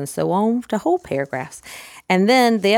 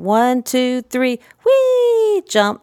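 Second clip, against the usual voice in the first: wee swooping much higher, louder play voice; sing-song run wee jump; 6 semitones higher, 4 dB louder, much slower than usual. Shown as a demonstration. Playing horse jumping the fence for a child, sing-song. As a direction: bounce it like a galloping horse. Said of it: "same kind of intonation".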